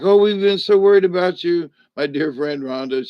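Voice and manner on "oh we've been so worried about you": higher, louder fussing worry; imitating worried friends, raised pitch and volume; mock worried fans. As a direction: ham up the fretting friends. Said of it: "who are like".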